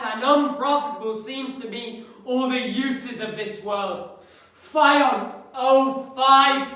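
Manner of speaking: loud, anguished classical soliloquy; Hamlet. Acted. Hamlet alone lamenting a weary world, impassioned outcry.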